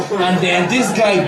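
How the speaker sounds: usual speaking voice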